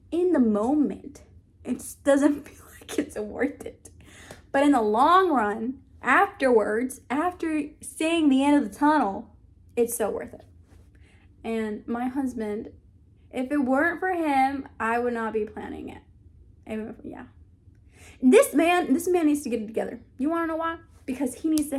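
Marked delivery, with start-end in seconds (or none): 1.6-3.9 laughing whisper. Real, not acted. Laughing whisper about fasting. 18.2-20.0 wife mock-complaining about her husband; exasperated. Real, not acted.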